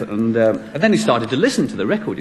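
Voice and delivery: ordinary delivery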